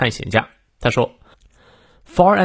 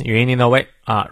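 usual speaking voice

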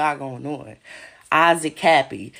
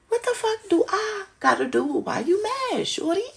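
high mocking taunt; 12 semitones higher than usual. Taunting the people who are mad at her, high and teasing.

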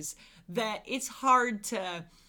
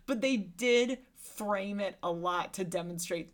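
usual speaking voice

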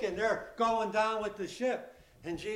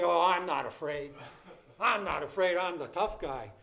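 lower, laughing mock toughness; faked bravado; 4 semitones lower than usual. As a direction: put on a brave face you don't feel. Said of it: "you can fake it".

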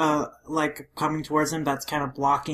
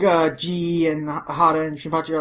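normal talking voice

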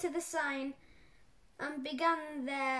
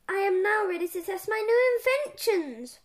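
louder, higher proud announcement; yelled announcement; 5 semitones higher, 7 dB louder than usual. A narrator's reading. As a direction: announce it loudly to the assistant. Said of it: "Pippi yelled".

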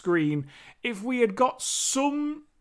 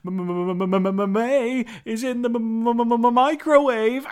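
hammed-up stutter, climbing louder and higher; mock stammer; 4 semitones higher, 4 dB louder than usual. Imitating the actor talking to the camera, exaggerated stammer. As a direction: stutter on the m's, building to the last word. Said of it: "he's looking at the audience, and he's like".

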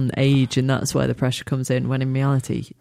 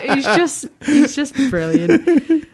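much higher, slow laughing praise; 12 semitones higher, 4 dB louder, much slower than usual. Retold or imitated. Retelling her reaction to the comedian, laughing. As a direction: gush through laughter. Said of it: "And I was just like".

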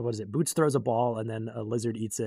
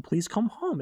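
put-on a high, pleading plea from a sweet old man; 11 semitones higher, 5 dB louder, slower than usual. Old father begging his son to return, high and pleading. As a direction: beg the lost son to come home. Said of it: "the sweetest old man of all time".

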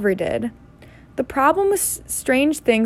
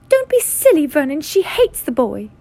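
dismissive aunt; 6 semitones higher, 3 dB louder than usual. A narrator's reading. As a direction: scold your husband in a high aunt voice. Aunt dismisses uncle's suggestion.

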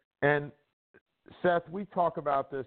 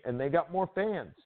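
ordinary delivery

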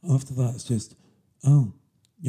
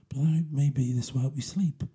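ordinary talk, nothing marked

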